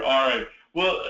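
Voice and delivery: ordinary talk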